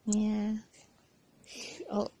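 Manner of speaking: ordinary delivery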